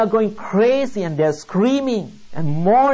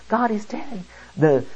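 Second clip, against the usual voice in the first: ordinary delivery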